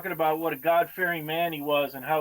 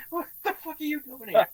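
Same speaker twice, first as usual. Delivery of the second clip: higher, incredulous challenge; wife's indignant shock; 9 semitones higher than usual. Retold or imitated. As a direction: confront the stranger in disbelief. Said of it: "His wife's looking at her like".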